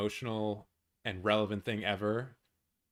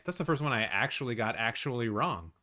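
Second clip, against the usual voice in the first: ordinary talk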